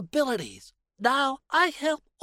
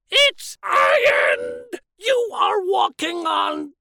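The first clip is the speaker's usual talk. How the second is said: furious shout; 4 semitones higher, 7 dB louder, slower than usual. Acted. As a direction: shout it out, slow and loud. Explodes over ironed blazer.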